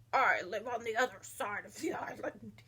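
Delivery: low, soft toad voice; large toad. Acted. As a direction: speak low as the old toad. Large toad speaks to frogs.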